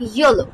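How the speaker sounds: ইয়েলো said with the first sound as a gliding ই; teacher models ইয়েলো, the Y sounding like ই; Yellow with a Y sound. Shown as a demonstration. Teacher shows students ইয়েলো, Y sounding as ই. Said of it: "ই উচ্চারণ করেছি".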